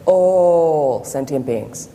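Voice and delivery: very slow, drawn-out teacher's voice; imitating Lama Zopa Rinpoche. Student imitating her teacher's slow emphasis, drawn out. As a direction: stretch each word like the old lama. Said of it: "That's how he speaks".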